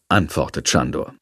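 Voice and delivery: ordinary delivery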